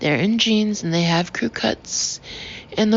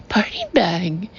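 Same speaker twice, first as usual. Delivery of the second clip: ordinary delivery, nothing marked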